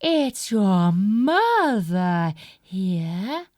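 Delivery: a lightly sweetened voice, drawn out at the end; soft lovely wolf voice. Acted. Wolf at the window sweetly pretends to be mother. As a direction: coax them sweetly like a mother. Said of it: "his voice went all soft and lovely".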